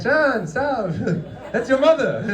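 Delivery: mock mother's high-pitched protest. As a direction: squeak it out like his mother pushing him off. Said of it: "Like, like".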